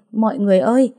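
calling to bystanders. Acted. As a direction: lift the voice to rally the crowd.

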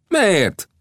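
very high, indignant outburst; indignant flare-up. Acted. Gibson flaring up at being scolded, indignant. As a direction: flare up in protest. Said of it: "fortyant fel Gibson".

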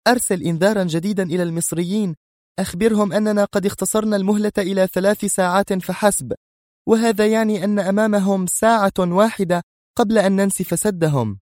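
urgent, high-pitched orders; سونيا. A narrator's reading. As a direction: call out a threat in a high commanding voice. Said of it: "ثم استدارت إلى رجل آخر صائحة". (أوامر حادة بصوت عال الطبقة)

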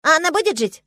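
high, loud squeaky question; squirrel wife. A narrator's reading. Squirrel wife asks if she will live, squeaky.

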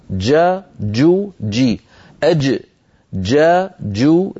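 جا جو جي with a fully closed jeem; stopped jeem, drilled syllable by syllable; classical jeem demo. Shown as a demonstration. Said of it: "هكذا كانت العرب في زمن النبوة تنطق الجيم".

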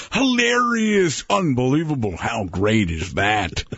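slow, stretched, over-enthused; Bill Walton voice. Retold or imitated. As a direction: drawl "hilarious" out slow and amazed. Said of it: "there's Walton going".

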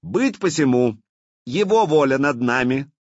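loud, high, declaring cry; shouted proclamation. A narrator's reading. Блуд enforces the prince's will on the council, shouting. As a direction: ring it out to silence the grumbling. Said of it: "закричал Блуд, пресекая недовольный ропот".

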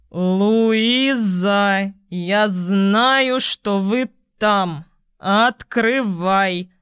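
put-on very slow drunken yell, dragging words. A narrator's reading. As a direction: bellow it drunk, stretching every word. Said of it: "заорал кто-то пьяным голосом, безобразно растягивая слова".

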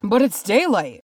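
high, loud protest; crying out. A narrator's reading. Dwarf objecting that orcs avoid daylight, crying out. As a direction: exclaim in disbelief. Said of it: "cried Bifur".

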